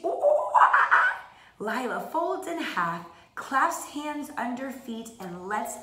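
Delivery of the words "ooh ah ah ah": high loud monkey hoots; gorilla screech. Acted. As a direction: screech like an ape. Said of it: "Eight gorillas screech".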